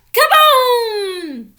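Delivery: loud high boom; loud high crash cry; holler. A narrator's reading. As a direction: blast the word out. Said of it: "he hollered".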